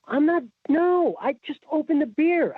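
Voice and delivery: insisting in disbelief; exasperated. Retold or imitated. Defending himself to cop.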